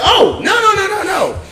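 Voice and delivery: high mock shout. Thief walking out.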